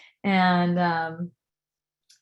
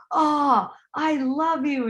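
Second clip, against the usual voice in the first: mildly gushing quote, higher voice; gushing affection; 7 semitones higher, 3 dB louder, slower than usual. Retold or imitated. Retelling her hugging me, mock gushing. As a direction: imitate a delighted new friend, pitched up.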